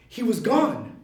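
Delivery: usual speaking voice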